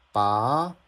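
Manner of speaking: "ba" sliding upward to the end; second tone. Shown as a demonstration. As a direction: lift the pitch steadily through "ba". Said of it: "Here's second tone".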